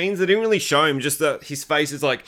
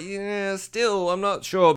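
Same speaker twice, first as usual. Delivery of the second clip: higher, softer and unconvinced; mock hesitant doubt; 4 semitones higher, 4 dB quieter, much slower than usual. Woman answering his defence of the killings, hesitant. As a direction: waver as you act out her reluctance. Acts out her reluctant doubt.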